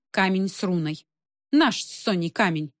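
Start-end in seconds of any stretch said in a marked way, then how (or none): none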